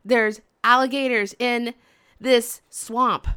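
normal talking voice

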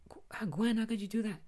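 mock indignation. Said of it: "like, very mad".

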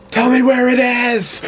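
Jack Bauer interrogation yell, high and drawn out; yelling at a suspect as Jack Bauer; Jack Bauer shout. Pretend. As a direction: demand it like a TV agent losing his temper. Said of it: "Like Jack Bauer?".

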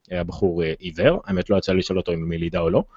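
ordinary talk, nothing marked

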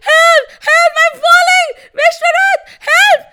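very high, loud screams for help; panicked shouting. A narrator's reading. Trishanku falls again and calls Vishwarath, panicked shouting. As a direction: yell in falling panic. Falling man cries for help.

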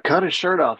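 mock order. Retelling ordering a teammate.

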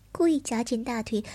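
plain reading voice

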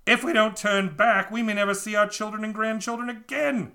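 pleading sailors. A narrator's reading. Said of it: "said the men".

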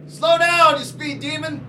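loud high yell after the driver; shouting at a car. Acted. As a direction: bellow it at the speeding car. Yelling at speeding car.

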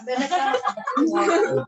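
amused, laughing as she tells it. Real, not acted. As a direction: let the laugh carry the line. Laughs while recounting son's words.

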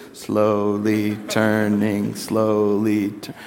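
very slow, low, drawn-out words; slowly turning. Shown as a demonstration. Preacher shows how the driver really turned, slow low drawl. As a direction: stretch every syllable out in a low drone. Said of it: "it was like this".

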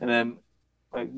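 normal talking voice

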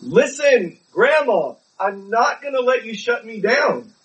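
a grandson yelling at his stubborn grandma; imagined yell. Retold or imitated. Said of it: "I might have yelled at her".

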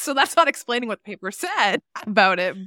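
ordinary delivery

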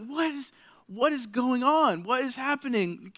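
high-pitched mock panic; retold baffled alarm. Dad watching his baby bawl, mock-alarmed. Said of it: "But I'm like".

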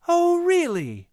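slow, high teasing "Oh, really?"; playful. Acted. Mother teasing her son, slow and playful. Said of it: "his mother asked playfully".

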